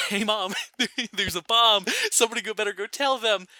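high, loud, imitated cheeky kid calling Mom; laughing wisecrack. Grandson heckles the priest's hymn to Mom at Mass, laughing mock alarm. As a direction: voice a brother's joke through giggles.

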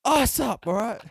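loud, high, hammed-up command; mock barked orders. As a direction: order them about at a yell. Acted worker barking orders.

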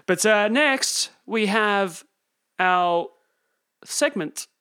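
slow, loud, spaced-out robotic announcement. Acted. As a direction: drag each word out like a robot. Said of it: "I don't know why I'm talking like a robot".

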